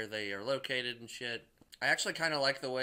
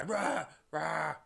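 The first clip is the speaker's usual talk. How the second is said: short barked roars, higher and louder; mock yell; 4 semitones higher, 3 dB louder than usual. Hulk yelling at the lightning, mock roars. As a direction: roar up at the sky like Hulk. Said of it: "yelling at the sky".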